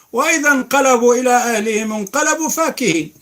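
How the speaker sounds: high, loud chanted recitation; Quran recitation. Acted. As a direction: lift the verse in full melodic chant. Recites verse, transliterated.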